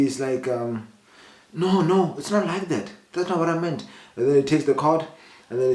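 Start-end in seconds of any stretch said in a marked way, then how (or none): 1.5-3.9 retold protest. Retold driver's protest when accused.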